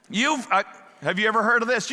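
ordinary talk

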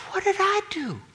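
whiny Joseph. Retold or imitated. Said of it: "Joseph's saying".

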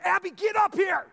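loud, high angry shout; mad dad. Retold or imitated. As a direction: yell up-here orders at your kid. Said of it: "really mad".

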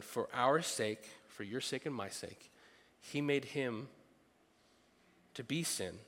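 scripture reading. Acted. Reads scripture slowly.

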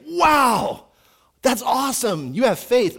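high, loud delighted wow; amazed wow. Acted. Jesus amazed at great faith, delighted. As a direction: marvel out loud with joy. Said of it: "amazed by the amount of faith".

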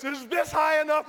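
high, loud anxious shout; acted-out frantic shout; panicked question. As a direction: call out urgently for reassurance. Anxious shouted question with legs raised.

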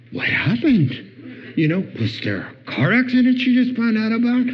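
high-pitched, incredulous questioning; bewildered alarm. Retold or imitated. Teacher baffled by sobbing student, bewildered alarm.

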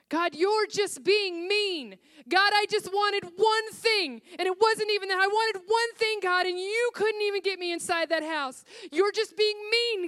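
sobbing in the car, telling God he is mean; retold sobbing complaint. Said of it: "I began to sob".

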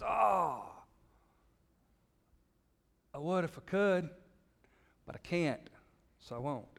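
a different voice, mock excuse for not giving; excuse-making response. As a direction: sound like someone hiding behind an excuse. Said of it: "but our response is".

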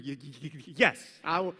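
quaking, stuttering yes; mock trembling stutter. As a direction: stammer the yes in mock fear. Mock terrified servant.

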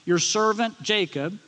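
servant drawn out slowly and firmly; slow down to emphasize servant; stress on servant. Acted. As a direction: give servant deliberate, weighty emphasis. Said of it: "Emphasize that word servant".

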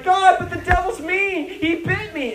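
put-on high, childish whine; whiny complaint. Believer whining to God about the devil, childish. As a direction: whine to God like a bitten kid. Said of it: "we're like".

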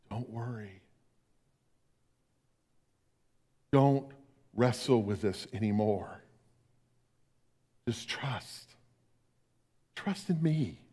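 very soft, gentle comfort; tender reassurance. Acted. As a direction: soothe someone taking their last breath. Jesus comforting the dying.

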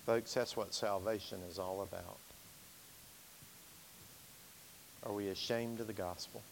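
soft low altar-call voice; quiet pastoral appeal. Real, not acted. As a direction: draw them in gently toward the altar. Quiet invitation after preaching.

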